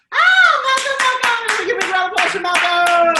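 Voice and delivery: high, loud cheer of greeting; real delighted shriek, very high; excited welcome. Real, not acted. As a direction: whoop and rally the room for applause. Greeting a student who just arrived.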